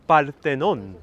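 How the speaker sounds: Parthenon in a Spanish accent, rolled r, open vowels; Spanish-flavoured Greek. Shown as a demonstration. Trying a Spanish accent on Greek words for viewers, Spanish accent. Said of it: "Am I sounding more Greek now".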